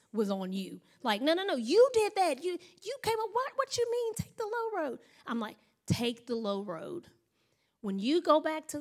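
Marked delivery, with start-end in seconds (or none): 1.2-5.0 high-pitched, incredulous objection; comic disbelief at being told off; mock protest. Acted objection to the advice. 5.9-7.1 low, slow, softer advice; measured, quiet counsel; calm insistence. Retold or imitated. Calm answer to the acted protest.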